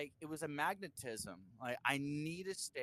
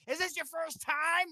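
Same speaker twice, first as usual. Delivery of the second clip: higher, louder buddy voice for Trey; high mock Trey; 14 semitones higher, 7 dB louder than usual. Musician quoting Trey on the winners' couch, high and loud. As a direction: play Trey as an excited rocker.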